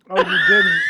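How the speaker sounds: excited shout. Real, not acted.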